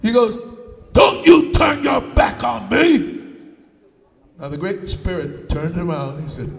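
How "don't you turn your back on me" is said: slow, high, forceful challenge; giant with attitude. Acted. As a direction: call him out, hitting each word slowly. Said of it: "now the giant had an attitude".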